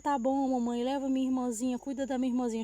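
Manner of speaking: ordinary delivery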